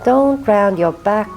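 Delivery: usual speaking voice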